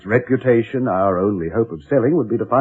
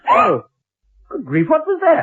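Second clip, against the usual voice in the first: jumps up high in alarm; sudden fright at a crash; startled cry. Acted.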